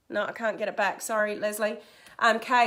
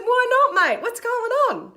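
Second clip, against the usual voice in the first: high, loud exasperated questioning; mock exasperation at a stalling student; retold incredulous challenge; 11 semitones higher, 6 dB louder than usual.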